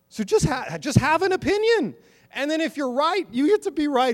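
urging the congregation to trust their own minds, impassioned preaching; preaching at full force. Acted.

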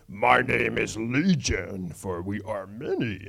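low, slow, drawn-out demonic voice; slow, deep possessed-man voice. Acted. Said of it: "And he answered, saying".

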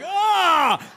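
high, loud comic wail of dismay; exaggerated cry of wounded vanity; mock scream. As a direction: let out a long hammed-up "Ah!". Mock horror at wife's reply.

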